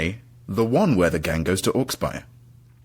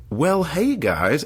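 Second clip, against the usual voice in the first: bright drawled American "hey guys"; American twang; 8 semitones higher, much slower than usual. Pretend. To his friends back home, American accent. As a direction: greet them like a born-and-bred Yank. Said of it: "taken on kind of an American twang".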